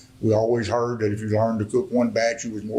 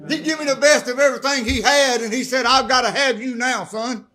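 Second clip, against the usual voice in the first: impassioned preaching; 12 semitones higher than usual. Real, not acted. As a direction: preach it out with everything you've got, climbing higher. Preaching at full force.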